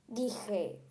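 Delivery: ordinary talk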